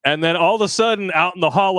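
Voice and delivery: normal talking voice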